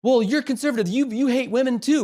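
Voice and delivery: high, put-on opponent's voice; mimicked retort. Woman at the gym accusing a conservative, high mimicked voice. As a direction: imitate the other side's accusation, pitched right up.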